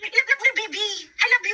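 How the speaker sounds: very high, playful cooing. Real, not acted. Telling the baby "I love you", high baby talk.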